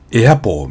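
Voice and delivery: エアポー opening on a wide 'æ'; American A. Shown as a demonstration. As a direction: say エアポー as English apple. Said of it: "みたいな感じですね".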